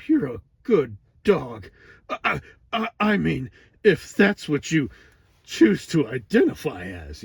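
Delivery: high, loud put-on cartoon voice; hammed-up cartoon bit, high and loud; cartoon character. Acting out a cartoon scene.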